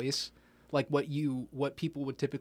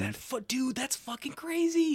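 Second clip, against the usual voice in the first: high-pitched stunned exclamation; shocked disbelief; 12 semitones higher than usual. Real, not acted. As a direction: voice jumps way up in shock. Reacting to diamond news.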